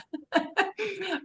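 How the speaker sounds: high breathy giggle through a mouthful; embarrassed giggle. Real, not acted. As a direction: giggle softly, caught eating. Embarrassed laugh, mouth full.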